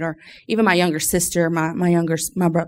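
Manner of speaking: ordinary talk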